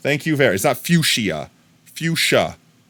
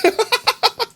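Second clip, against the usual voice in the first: shrieking burst of laughter; squealing laugh; high cackle. Real, not acted.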